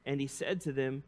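normal talking voice

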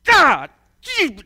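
loud, high mock-furious outbursts; frustrated striking grunts. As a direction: grunt out each blow in acted frustration. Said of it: "he was so frustrated, it was like".

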